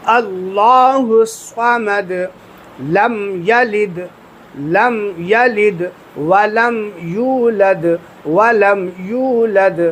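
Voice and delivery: very slow chanted اللہ الصمد, لم یلد ولم یولد; correct recitation. Shown as a demonstration. Teacher recites the correct way, slow tajweed chant. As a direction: draw each word out clearly. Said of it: "یہ صحیح طریقہ ہے".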